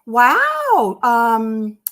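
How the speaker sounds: lie sweetly, playing dumb; pretending not to know; fake innocence. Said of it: "I lied".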